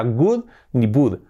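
"would" hardened into "good" and "bood"; mispronounced would. Shown as a demonstration. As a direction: show "would" wrongly starting with G or B. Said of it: "Que no sea". ("would" mal dicho, como "gud" o "bud")

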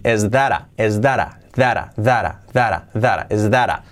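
"that a" run together with a soft tapped T, like "dara". Shown as a demonstration. Teacher drills how natives say it, soft tapped "that a". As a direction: flap the T in "that a" into a quick soft R. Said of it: "La T como resuave".

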